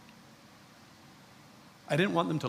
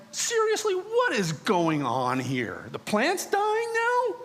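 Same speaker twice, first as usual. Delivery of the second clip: 1 exasperated disbelief; 12 semitones higher, slower than usual. Retold or imitated. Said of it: "And it was like".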